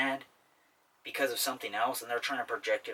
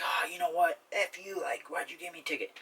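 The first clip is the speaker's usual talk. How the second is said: higher-pitched, hammed-up rant at the cop; mock angry outburst; 7 semitones higher than usual. Driver mouthing off to the cop who pulled him over, mock furious. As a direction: play the hothead mouthing off to a cop. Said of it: "you start just like".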